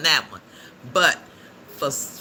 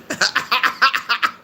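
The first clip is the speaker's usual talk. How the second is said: high, loud burst of laughter; real burst of high laughter; 12 semitones higher, 6 dB louder than usual. Real, not acted. Cracks up at a chat comment.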